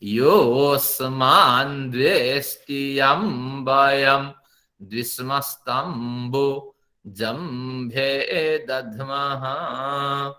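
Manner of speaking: very slow, stretched Vedic mantra recitation; Rigvedic recitation. Shown as a demonstration. Teacher recites the mantra for students in Rigvedic style, very slowly. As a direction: intone each syllable, long vowels held. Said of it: "योस्मान को पढ़ते हैं".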